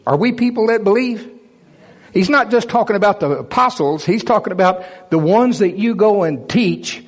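rising, building preacher cadence; rising preaching. Acted. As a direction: preach the promises with mounting fervour. Preaching the signs passage.

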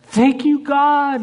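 very high, loud, ecstatic thanks; high, loud overjoyed gushing; gushing joy. Retold or imitated. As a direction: squeal with thanks like a dream came true. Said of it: "right before you grab it".

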